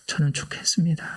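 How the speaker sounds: a barely voiced surrender; whispered vow to God; whispered prayer. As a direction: breathe the last words of the plea.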